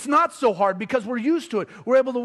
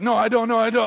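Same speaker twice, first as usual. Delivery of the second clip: louder, agitated refusal; mock-stubborn outburst; acted protest; 5 dB louder than usual. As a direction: push back loudly, as if arguing with yourself. Acts resisting his own conviction.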